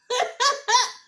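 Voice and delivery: loud high cackle; real laugh breaking out; high burst of laughter. Real, not acted. Vlogger laughing at Zach on the show, loud high laugh.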